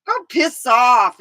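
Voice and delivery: snapping back at her grumpy uncle; mock retort. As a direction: fire it back at him. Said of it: "I'm like".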